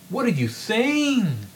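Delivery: incredulous exclaim. Acted. Professor stunned by the student's denial, exclaiming in disbelief. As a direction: demand it in outrage. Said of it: "Newman exclaimed".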